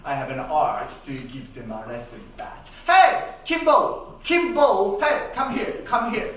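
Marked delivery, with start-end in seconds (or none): 2.9-6.3 loud, high call across the house; father calling his son Kimbo, loud and high. Acted. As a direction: summon the kid.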